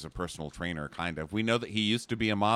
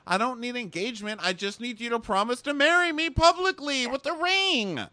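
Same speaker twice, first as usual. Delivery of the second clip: high put-on voice; hammed-up pleading; mock girlfriend; 5 dB louder than usual. Girlfriend begging for a public ring, high and pleading.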